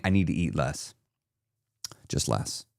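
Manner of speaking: soft, low self-talk; quiet resolve. Retold or imitated. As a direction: murmur it to yourself. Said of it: "you just started saying".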